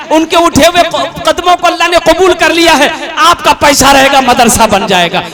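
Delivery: loud fervent appeal for the madrasa; preaching appeal. Acted. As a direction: rouse the crowd to give. Loud fundraising sermon. (Josheela Madrasa Chanda appeal)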